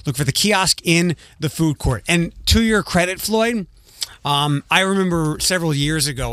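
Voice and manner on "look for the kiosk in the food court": high, bright on-air host read; radio announcer. Acted. Host returning from break to listeners, bright announcer read. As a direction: sell the show and event with energy.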